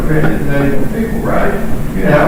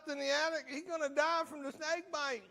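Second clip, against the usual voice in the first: normal talking voice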